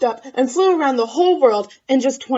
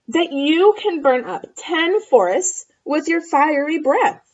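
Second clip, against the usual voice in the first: put-on eager girl voice; 6 semitones higher, 6 dB louder than usual. Elizabeth flatters dragon.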